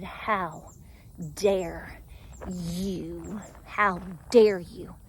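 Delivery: low, slow indignant scolding; stern self-rebuke. Retold or imitated. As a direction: scold yourself slowly and quietly. Scolding herself in the mirror.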